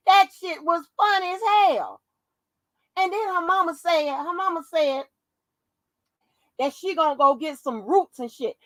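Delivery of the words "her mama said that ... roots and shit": ordinary talk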